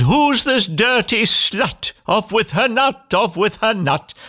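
high, ranting prince; hammed-up, high-pitched tantrum; outraged cry. A narrator's reading. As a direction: cry out a furious order. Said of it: "The prince cried".

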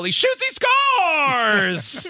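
slow, high hockey goal call; drawn-out hockey announcer shout; hockey style yell. Retold or imitated. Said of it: "I yelled hockey style".

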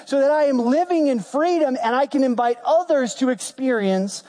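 high-pitched, impassioned declaration to God; preacher at the height of his appeal; fervent preaching. Acted. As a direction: cry it out as a defiant vow. Preaches an acted prayer at full force.

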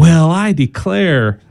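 slow, drawn-out Southern drawl; a light Southern accent, slowed down. Acted. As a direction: lightly play a Southern lady exclaiming. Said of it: "your voice getting Southern sounding".

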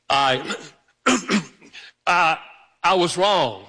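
halting, throat-clearing admission; struggling to admit fault. Acted. Husband owning up to his wife by phone, reluctantly. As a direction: force it out in stops and starts. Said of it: "I did not say it was easy".

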